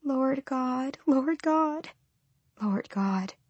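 soft, high, thin lament, sinking at the end; painful, sibilant cry. A narrator's reading. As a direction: pray softly in pain. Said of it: "Her cry was low, sibilant, painful".

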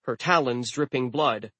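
ordinary delivery

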